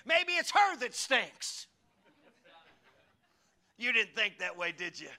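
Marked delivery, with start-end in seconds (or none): 0.0-1.7 slow punchline. Acted. Preacher punchline, slowed and high.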